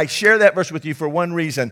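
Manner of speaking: usual speaking voice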